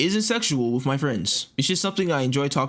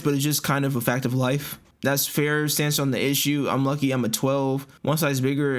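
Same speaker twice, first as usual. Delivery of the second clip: ordinary talk, nothing marked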